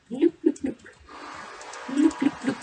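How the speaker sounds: high, loud sing-song loop loop; playful loop sounds. Pretend. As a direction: bounce the word up like a toy. Playful sound effects while building.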